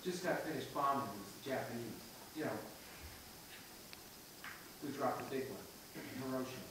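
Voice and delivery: low, soft, halting script reading; scooping cold read. Shown as a demonstration. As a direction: lift each phrase off the page, quietly, with long pauses. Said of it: "I'm scooping, I'm looking down".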